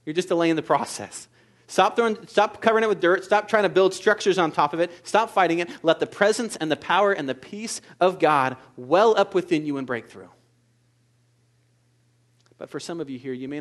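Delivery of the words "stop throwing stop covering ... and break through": louder insistent preaching; forceful exhortation. Acted. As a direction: drive each stop home. Exhortation, rising.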